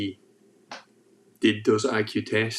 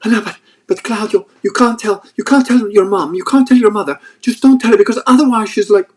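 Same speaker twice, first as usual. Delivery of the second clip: high, loud, pleading Italian wife voice; wife's voice; 11 semitones higher, 10 dB louder than usual. Retold or imitated. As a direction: plead with him in a much higher, louder voice. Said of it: "And she's going on like".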